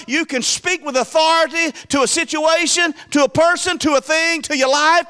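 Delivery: high, building preacher's cry; lifted Sunday pulpit voice; rising preaching. Acted. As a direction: preach it up to the rafters. Preacher rises into full preaching.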